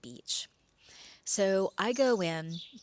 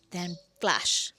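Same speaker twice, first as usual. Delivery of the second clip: normal talking voice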